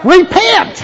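loud, high called-out repent with a laugh; mock command; playful call. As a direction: call it out like a game signal. Kids walking, preacher calls repent.

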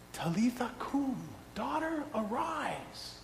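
high, soft call to wake her; gentle command. Acted. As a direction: coax her awake softly. Jesus raising the girl gently.

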